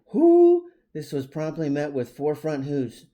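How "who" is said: very high, loud hooting "who"; high owl hoot. A narrator's reading. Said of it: "again replied".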